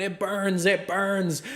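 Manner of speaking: high, wailing cries at modest volume; mock screaming. Bartender imitates maced girl, mock screaming. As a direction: imitate her hysterical screams without full force. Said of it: "She's just screaming".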